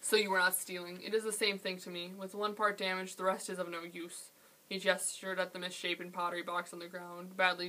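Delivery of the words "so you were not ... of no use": ordinary delivery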